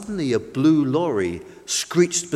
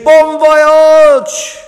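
high, loud cheer held out long; 12 semitones higher, 14 dB louder than usual. A narrator's reading. Boats cheer the maiden voyage, shouting high. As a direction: call it out like a crowd on the dock. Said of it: "they cheered".

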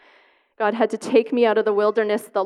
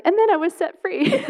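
high, bright mock fairy-tale ending; mock triumphant; 9 semitones higher than usual. To the congregation, mocking the tidy happy ending. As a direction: send up the neat happy ending. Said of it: "And I'm not saying".

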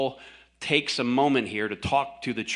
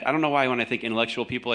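normal talking voice

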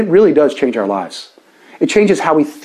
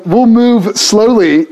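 drawn out, louder-edged playful slowness; deliberately slow; 6 semitones higher, 4 dB louder, slower than usual. Real, not acted. Pastor teasing that there is no rush, deliberately slow. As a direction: stretch it out nice and slow. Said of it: "we'll go nice and slow".

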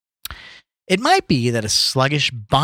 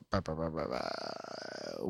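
slow, much softer and lower mumble; mumbled filler; 9 semitones lower, 18 dB quieter, slower than usual. Real, not acted. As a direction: trail off under your breath. Skipping text mid-reading.

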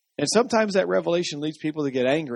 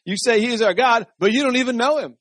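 high, loud reproach; emphatic rebuke; 7 semitones higher, 5 dB louder than usual. Acted. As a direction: throw it at them as an accusation. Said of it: "This is what I want to say to him".

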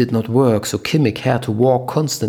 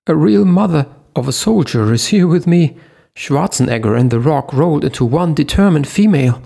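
higher, louder, rushing the last line; excited high voice; 6 semitones higher, 5 dB louder, faster than usual. A narrator's reading. Leo hyping up Eva from the car, loud high excitement. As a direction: keep the shrill excitement going.

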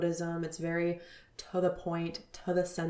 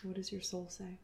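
repeated much softer after a long pause; softer echo; 8 dB quieter than usual. Real, not acted. Asking the listener again, soft and inward. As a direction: let the question sink in, quietly.

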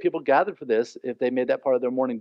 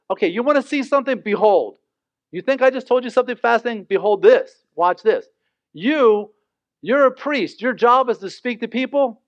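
higher, fast, exasperated angel rebuke; Gabriel, fed up, striking the priest mute in a higher, faster voice; angry angel; 6 semitones higher, 3 dB louder than usual. Acted. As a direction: rattle it off like an indignant angel showing him up. Angel rebuking Zechariah, mock anger.

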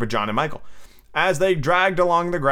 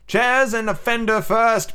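slow, high, emphatic command; ordering; 8 semitones higher, slower than usual. Acted. Peter setting the boys their first task, ordering. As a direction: lay down the order, word by word. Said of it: "Peter ordered".

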